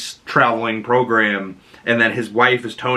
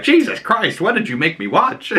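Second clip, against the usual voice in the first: mildly mock-appalled, higher pitch; a hint of an aghast mother, pitched up; imitated shocked mother; 8 semitones higher than usual. Voices mother's shocked reaction.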